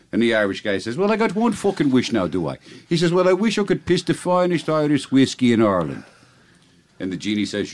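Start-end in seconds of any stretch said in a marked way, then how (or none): 1.0-2.6 high-pitched hammy Irish brogue, dropping at the end; bad stage-Irish, high then falling away; horrible Irish accent. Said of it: "your horrible accent that makes the joke". 3.1-6.0 higher-pitched joke-Irish voice; bad stage-Irish, pitched up; horrible Irish accent. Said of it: "your horrible accent that makes the joke".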